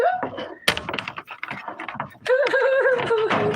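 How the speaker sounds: shaky giggle of real nerves; nervous laugh. Real, not acted. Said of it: "that's a nervous laugh".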